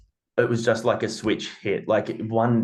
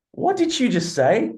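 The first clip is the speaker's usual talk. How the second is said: shocked disbelief; 14 semitones higher than usual. Retold or imitated. Dad's stunned reaction to daughter.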